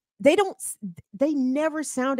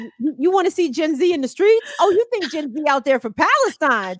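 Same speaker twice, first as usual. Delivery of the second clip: loud, higher-pitched challenge, building to a shout; fired-up rant; 6 semitones higher, 6 dB louder than usual. Acted. Host warning what banning TikTok would unleash, fired-up rant. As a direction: throw down a dare, rising to a yell.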